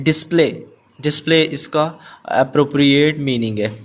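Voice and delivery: usual speaking voice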